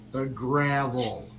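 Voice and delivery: The gravel. drawn out slowly, weight on gravel; emphatic slow repeat. Real, not acted. Reliving the crash for listeners, stressing gravel slowly. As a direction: lean on gravel, slow it right down.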